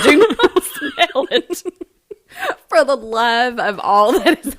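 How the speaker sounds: tearful laughing plea. Real, not acted. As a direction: beg through the giggles. Pleading through laughter.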